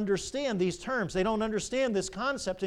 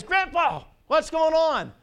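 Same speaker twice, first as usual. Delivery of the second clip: put-on high, loud young grandson's call; 9 semitones higher, 6 dB louder, slower than usual. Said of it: "And he says".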